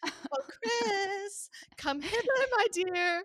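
high, hammed-up old-fashioned cry for help; mock damsel plea. To her husband while drenched in vomit, a mock damsel cry. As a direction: overplay a swooning lady calling for rescue.

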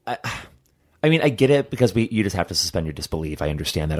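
normal talking voice

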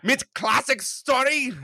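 put-on very high, slow German accent; high slow hammed-up German accent; mock German sommelier. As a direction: present it like a German wine steward.

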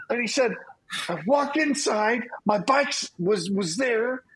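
high panicked pleading voice; imitating a frantic younger brother accusing. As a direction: plead high and fast like a scared kid. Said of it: "he says".

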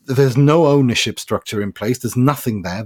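ordinary talk, nothing marked

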